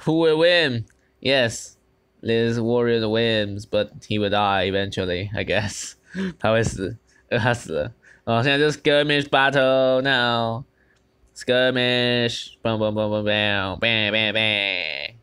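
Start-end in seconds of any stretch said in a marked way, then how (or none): 0.0-0.8 louder, higher hype; hyped up. Acted. Streamer hyping the crowd about the fight, with a loud, high call. Hyped question mid-battle. 12.6-15.1 lazy mouth sound effects of blows; fight noises. Shown as a demonstration. Streamer making his own battle sound effects, casual bam bams. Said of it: "我根本就没声写音效".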